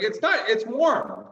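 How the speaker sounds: mock insistence. Surfer insists the cold water is warm, high mock insistence.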